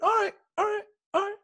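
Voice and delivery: high-pitched group call; rowdy mock cheer. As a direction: imitate teammates rallying for a birthday. Rowdy team starting birthday hazing.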